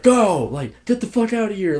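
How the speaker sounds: high-pitched mock order to leave; angry neighbor. Neighbor ordering a stalled driver away, mock angry.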